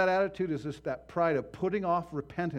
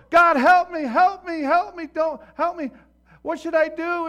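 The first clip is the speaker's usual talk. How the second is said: high, very loud frantic cries to God; desperate yell for help; 14 semitones higher, 15 dB louder than usual. Acted. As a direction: scream up to God from the branch, panicked. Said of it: "he yells".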